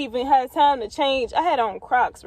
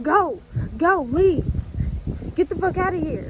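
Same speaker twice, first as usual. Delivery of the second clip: raised, higher barking orders to leave; boyfriend yelling; 5 semitones higher, 4 dB louder than usual. Retold or imitated. Boyfriend by the car door yells at her to leave, loud and higher. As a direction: shout her off the street, hand on the gun.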